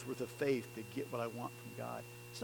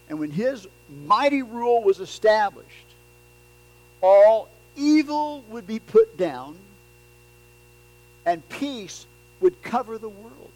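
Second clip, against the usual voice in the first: loud, higher, driving sermon voice; preaching at full force; 6 semitones higher, 13 dB louder than usual. Acted. Preacher urging the congregation to trust God, loud and driving. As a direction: press the point home to the congregation.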